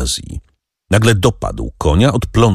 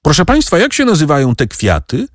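put-on high, childlike call; high-pitched boy calling to grown-ups; boy's voice; 9 semitones higher than usual. A narrator's reading. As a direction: ask eagerly like a young boy. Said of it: "Wtem usłyszeli głos Tomka".

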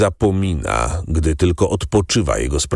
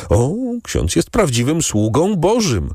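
a lightly voiced cry, pitched higher; exclaiming; 9 semitones higher than usual. A narrator's reading. Innkeeper exclaiming in awe to the priest, higher. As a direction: call it out in awe, up high. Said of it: "wykrzyknął Kadrus".

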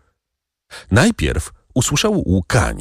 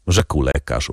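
usual speaking voice

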